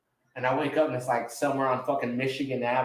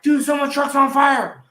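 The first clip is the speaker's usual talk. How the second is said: higher, louder imitated shout; retold alarm call, much higher; mock alarm; 11 semitones higher, 8 dB louder than usual. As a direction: yell it like a panicked partygoer. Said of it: "and everyone was like".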